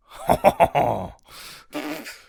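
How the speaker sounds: loud, high mock French laugh; hammed-up French laugh. Host shows off her worst-parody French laugh. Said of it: "I can do a French laugh".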